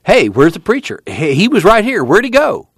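Tom, suddenly puzzled; sudden question. A narrator's reading. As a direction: look round for the missing preacher. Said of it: "Suddenly Tom said".

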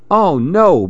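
a lightly voiced wail of worry; crying out in dismay. A narrator's reading. Billy McGill finding the animals asleep, crying out. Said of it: "Billy cried".

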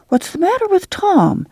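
lightly voiced child, high-pitched question; a hint of a high child's voice; curious child. A narrator's reading. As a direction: pipe up high like a curious kid. Child pesters Ma about Tom.

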